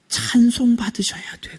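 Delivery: slow high whisper; whispered quote. Acted. Preacher voicing Job praising God after losing all, whispered. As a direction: breathe Job's words in awe. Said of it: "그랬어요".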